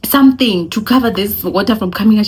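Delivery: fast, high anxious question; anxiously asking sister-in-law. Retold or imitated. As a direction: rush the worried question. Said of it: "I'm like".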